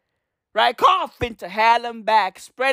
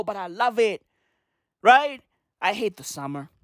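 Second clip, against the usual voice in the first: ordinary delivery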